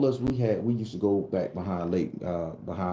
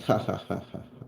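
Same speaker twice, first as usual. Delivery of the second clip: snake's laugh; 4 dB quieter than usual. Retold or imitated. Snake laughing at the scared boy, mock laugh. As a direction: act out a laughing snake, dying away. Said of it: "the snake started laughing".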